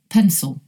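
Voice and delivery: "Pencil" with strong PEN, weak schwa ending; stress on first syllable. Shown as a demonstration. Teacher giving another example, first-syllable stress. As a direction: stress the start of "Pencil", reduce the second vowel. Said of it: "The stress is on the first syllable".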